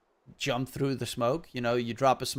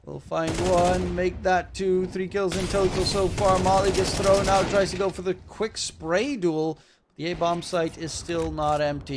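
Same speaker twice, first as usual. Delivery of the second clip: excited, raised caster voice; driving esports commentary, pitched up; live play-by-play; 5 semitones higher, 5 dB louder than usual. Pretend. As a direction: call the round with rising urgency. Live casting of the round.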